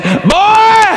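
booming high shout; big preacher voice. Acted. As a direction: call the boy across the church. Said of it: "a voice big".

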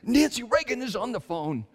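high-pitched mock panic, imitating his wife; breathless panic. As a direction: blurt it out in a squealed, hammed-up panic. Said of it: "out of breath and in a panic".

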